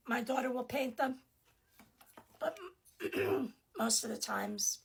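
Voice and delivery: ordinary talk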